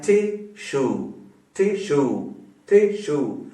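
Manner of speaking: टिश्यू in two separate beats, टि then श्यू; split into syllables. Shown as a demonstration. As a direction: say टिश्यू slowly, a gap between its two syllables. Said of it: "दो हो गए ना".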